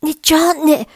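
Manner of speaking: loud high warning yell; loud high cry of warning. A narrator's reading. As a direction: shout a warning too late. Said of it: "Davery yells".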